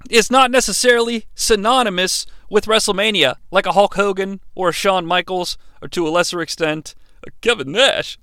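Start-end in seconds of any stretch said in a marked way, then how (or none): none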